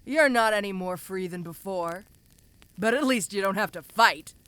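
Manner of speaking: ordinary talk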